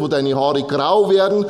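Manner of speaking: ordinary delivery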